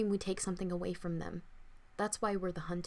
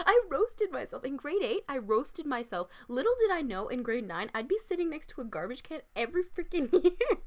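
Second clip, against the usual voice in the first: laughing; 8 semitones higher, 5 dB louder than usual. Real, not acted. Laughing commentary aside.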